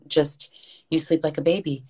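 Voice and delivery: usual speaking voice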